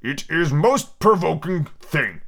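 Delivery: slow, drawn-out angry growl. A narrator's reading. Offended Humpty finally speaks, in a slow growl. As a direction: growl out each word with deliberate annoyance. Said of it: "it was in a deep growl".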